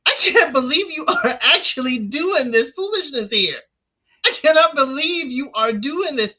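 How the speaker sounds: high, loud disbelief, half laughing; incredulous mock laugh. Quoting her laugh as disbelief at his foolishness. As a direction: play the baffled onlooker. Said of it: "the laugh of".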